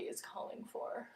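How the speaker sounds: whispering. Real, not acted. Guiding the stretch softly.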